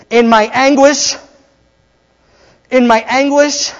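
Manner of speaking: loud, high, drawn-out verse call; rallying call. Acted. Preacher calling the psalm out to the congregation, loud rallying call. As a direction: proclaim it for the crowd to echo.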